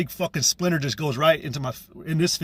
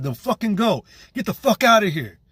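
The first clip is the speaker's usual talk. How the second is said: mildly raised, retold angry order; angry dismissal; 5 semitones higher than usual. Telling mother and son to leave, retold angry dismissal. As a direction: send them off with a touch of anger. Retells angrily sending the pair away.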